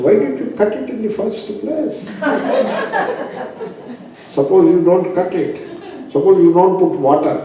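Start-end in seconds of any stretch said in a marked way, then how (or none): 0.0-2.0 asked through a laugh; laughing question. Retold or imitated. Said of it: "asked the person another question". 2.0-3.9 high breathy chuckle; high laugh. Real, not acted. Laughs at his own question.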